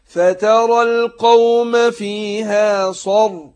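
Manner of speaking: slow chanted tajweed recitation, heavy nasal hum; Quran recitation. Shown as a demonstration. Teacher demonstrating recitation to students, slow chant. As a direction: recite slowly, drawing out the ghunna.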